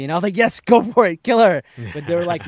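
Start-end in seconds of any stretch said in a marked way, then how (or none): 0.4-1.6 eager shout. Retold or imitated. Egging on the show's heroine.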